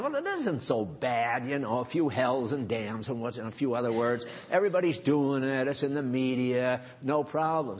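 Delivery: dismissive excuse. Retold or imitated. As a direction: brush it off like the average guy. Average guy excusing swearing.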